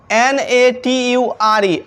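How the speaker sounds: slow letter-by-letter spelling of nature. Shown as a demonstration. Teacher spelling the word nature for students, slowly. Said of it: "कुछ example में हम लोग देखते हैं nature".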